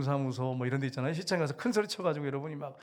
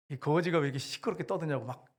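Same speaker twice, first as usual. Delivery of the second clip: ordinary talk, nothing marked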